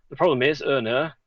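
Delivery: demonstrating Yorkshire speech from Hull; Hull accent. Shown as a demonstration. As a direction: put on a proper Hull accent. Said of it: "put on a proper Hull accent".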